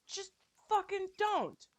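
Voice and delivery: high, slow drawn-out don't; exasperated plea. Real, not acted. Telling viewers to stop, high slow exasperation. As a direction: drag it out, fed up. Some dark jokes go too far.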